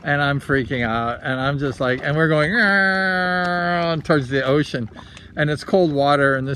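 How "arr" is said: long, high engine whine sliding down; imitated plane dive. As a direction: mimic a falling plane with a drawn-out noise.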